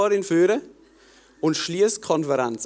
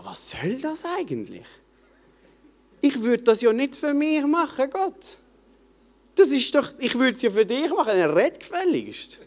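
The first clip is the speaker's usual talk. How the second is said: mildly angry at God; 7 semitones higher than usual. Retold or imitated. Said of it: "hässig geworden auf Gott".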